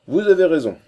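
loud, high burst of agreement; emphatic exclamation. A narrator's reading. Breton captain answering Holkar who would rather die, emphatic exclamation. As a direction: start high and falling, loudly conceding the point.

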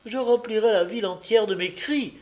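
high, loud, passionate protest; impassioned cry. A narrator's reading. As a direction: cry out in defiance. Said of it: "s'écria l'enfant d'un ton passionné".

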